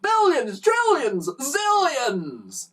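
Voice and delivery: high, gleeful escalation; chuckling boast. A narrator's reading. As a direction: pile up the boast, pitched high and amused. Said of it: "his wife chuckled".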